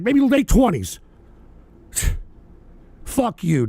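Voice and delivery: usual speaking voice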